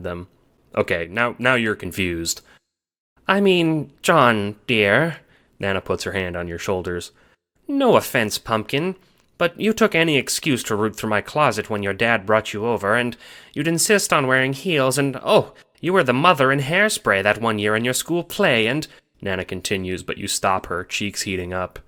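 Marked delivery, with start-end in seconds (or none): 3.3-5.2 higher, gentle grandma; lightly voiced Nana; Nana's voice. A narrator's reading. 7.7-18.9 higher, gentle grandma; lightly voiced Nana; Nana's voice. A narrator's reading.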